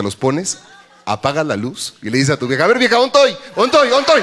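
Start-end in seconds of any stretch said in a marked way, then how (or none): 2.6-4.2 high, loud playful calling in the dark; mock high teasing call. Man teasing his wife in the dark, high playful call.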